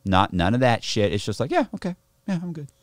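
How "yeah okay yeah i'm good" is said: higher, light mock nonchalance; brushing it off. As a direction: imitate shrugging it off as nothing. Acts shrugging off the fight.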